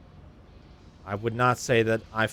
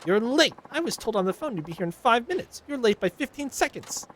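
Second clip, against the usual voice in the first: higher, scolding complaint; nagging Karen; 13 semitones higher, 3 dB louder than usual. Acted. Karen passenger scolding the taxi driver, higher nagging voice. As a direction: nag the driver for being late. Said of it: "Nagging [name]".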